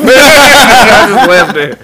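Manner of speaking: high, slow, loud laugh through the words. Real, not acted. He laughs telling his cohost about the quiz. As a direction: crack up at the memory.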